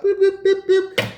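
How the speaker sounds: very high boop sounds; button boops. Retold or imitated. Setting up the video camera, high little boops.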